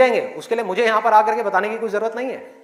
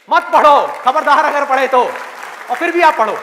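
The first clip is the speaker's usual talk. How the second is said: mock forbidding; 4 semitones higher, 6 dB louder than usual. Everyone forbidding you to study.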